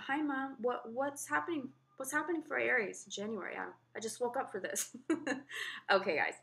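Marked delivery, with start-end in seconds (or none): 0.1-5.0 high, cutesy voice for the cat; voicing the cat. Owner voicing her cat waking up and saying hi, high cutesy voice. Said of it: "her little head just peeks over the camera". 5.0-5.9 light laugh. Real, not acted. Laughs at her own cat voice.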